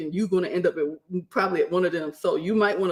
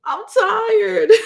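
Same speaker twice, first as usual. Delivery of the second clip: loud, high-pitched comic whine; mock wail; 8 dB louder than usual. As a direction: wail it out as exhausted complaint, half laughing. Retelling her exhaustion while crawling.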